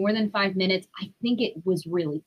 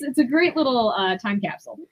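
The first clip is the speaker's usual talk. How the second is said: normal talking voice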